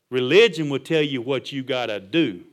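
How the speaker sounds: measured, emphatic words driven home slowly; slow, deliberate repeat. Shown as a demonstration.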